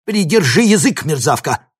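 loud, high furious rebuke; boiling with anger. A narrator's reading. Professor insulted by student's question, flaring up angrily. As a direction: flare up and lash out. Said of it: "вскипел Клебин".

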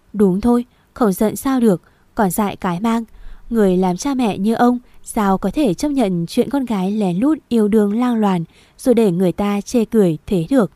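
ordinary delivery

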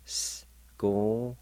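S-go-hin sounded out syllable by syllable; teacher demo of S-go-hin; Cherokee syllables. Shown as a demonstration. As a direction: break S-go-hin into parts. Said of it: "pronounced like this".

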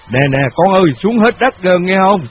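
calling out. A narrator's reading. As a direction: call across the house. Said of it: "lên tiếng kêu". (ông gọi các con, giọng to)

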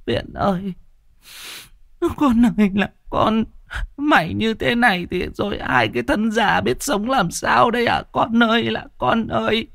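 high keening lament for a dead son; sobbing cry of a mother in mourning; wailing mother. A narrator's reading. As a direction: wail it out like a grieving old mother. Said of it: "ai oán khóc lóc".

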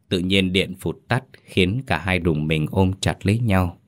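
plain reading voice

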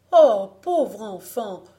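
loud, slow cry of pity; anguished exclamation. A narrator's reading. Mother pitying her dreaming child, crying out slowly. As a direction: lament loudly, drawing the words out. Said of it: "s'écria Marie-Jeanne".

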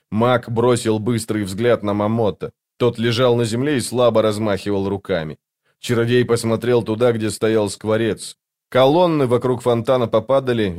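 usual speaking voice

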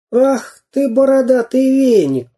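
high-pitched indignant cry; indignant exclamation. A narrator's reading. Самоделкин insults the bearded pirate, exclaiming indignantly. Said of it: "воскликнул Самоделкин".

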